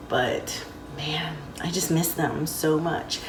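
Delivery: emotional whisper. Real, not acted. Missing her bonus family, she whispers with emotion. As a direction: let it drop to a soft, unvoiced whisper of missing them. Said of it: "I'm getting emotional".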